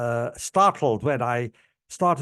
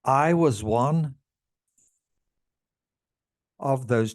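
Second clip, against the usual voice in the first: slow and halting, fading to almost nothing at the end; emotional. Real, not acted. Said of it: "Wow, I'm very emotional".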